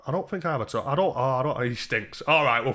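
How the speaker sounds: normal talking voice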